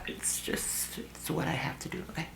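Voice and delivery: hushed whisper to the audience; a little whispered confession; whispered aside. Real, not acted.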